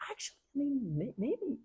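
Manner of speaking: high, soft, hesitant mumbling; mock-tentative second thoughts; sheepish backtracking. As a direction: reluctantly concede in a small high voice. World sheepishly reconsidering, acted out.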